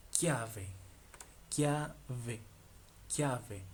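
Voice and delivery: chiave said as kya-veh; Italian pronunciation. Shown as a demonstration. Teacher models an Italian word for learners, careful pronunciation. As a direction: say chiave with a hard k, slowly and separately. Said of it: "la C H I, ki".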